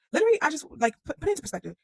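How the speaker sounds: sudden high, loud burst of excitement; excited outburst. Real, not acted. Bursting with excitement while retelling Abraham's faith. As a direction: blurt it out, bursting with amazement.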